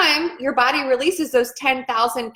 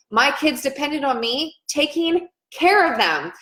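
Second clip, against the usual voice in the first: ordinary talk, nothing marked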